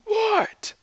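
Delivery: very high shouted reply; yelling back. A narrator's reading. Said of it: "Both yelled back".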